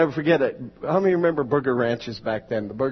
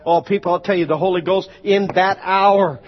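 high, loud, shouting sermon; fiery preaching; 11 semitones higher, 8 dB louder than usual. Acted. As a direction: preach it full force to the crowd. Preaching at full force.

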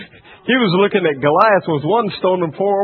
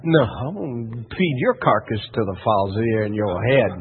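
low, softer retort as David; understated threat; 9 semitones lower, 6 dB quieter than usual. Retold or imitated. Preacher as David threatening Goliath back, low and cool. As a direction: answer the giant coolly, pitched down.

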